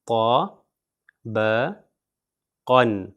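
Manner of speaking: طبقن drawn out very slowly, sound by sound; slow demo word. Shown as a demonstration. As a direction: spell طبقن out slowly for students. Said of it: "یہاں پر آپ دیکھیے". (طبقن کو بہت آہستہ کھینچ کر پڑھنا)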